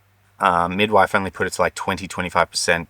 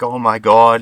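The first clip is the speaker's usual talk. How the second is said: happy tearful gasp; 4 semitones higher, 8 dB louder than usual. Retold or imitated. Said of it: "And she starts crying, happy tears".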